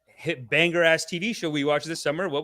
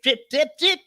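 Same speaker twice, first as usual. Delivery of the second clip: high, chirped repeats of the show name; playful echo effect; 11 semitones higher, 4 dB louder than usual. Pretend. Host plugging the Drip stream, playful high echo. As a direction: bounce it upward like a silly jingle.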